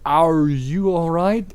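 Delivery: hammed-up worry; worried. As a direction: check on her, worried. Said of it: "in a worried voice".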